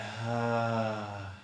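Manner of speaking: gentle voiced sigh, left to run out; released sigh. Shown as a demonstration. Teacher showing sighing until breath runs out, gentle released sigh. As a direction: sigh out easily without pushing. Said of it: "let it out on a sigh".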